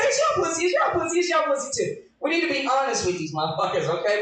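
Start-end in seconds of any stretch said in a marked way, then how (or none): 0.0-2.0 put-on rising higher into a cooing, girlish reassurance; sweet-talking reply. Woman sweet-talking her lover, cooing higher and higher. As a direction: coo it higher each time to please him. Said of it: "we gonna say".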